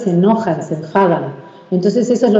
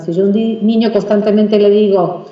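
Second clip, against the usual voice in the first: normal talking voice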